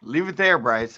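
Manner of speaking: high, loud eager plea; excited plea. Real, not acted. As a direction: beg him to leave it there. Begging to leave camera.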